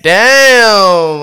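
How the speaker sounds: high, loud "damn" of awe; loud impressed exclaim. Retold or imitated. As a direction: blurt it out impressed. Reacting to thick chick.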